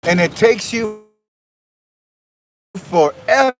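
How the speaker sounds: jolted voice over a bumpy ride; on a bumpy road. Real, not acted. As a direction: talk while bouncing on a cart. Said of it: "Sorry, it's so bumpy".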